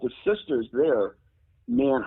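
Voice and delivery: ordinary talk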